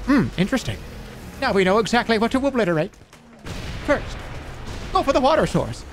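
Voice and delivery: high, scheming alien voice; sinister alien. Pretend. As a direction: plot the destruction. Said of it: "And then they go".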